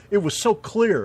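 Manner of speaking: loud high stress on so; emphatic. Real, not acted. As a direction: hit the word so hard. Emphatic about moonshine.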